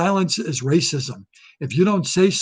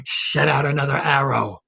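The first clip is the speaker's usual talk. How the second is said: evil movie villain. Retold or imitated. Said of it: "the evil doctor in Underneath the Volcano petting a cat".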